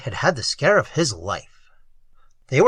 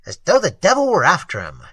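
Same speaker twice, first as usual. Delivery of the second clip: plain reading voice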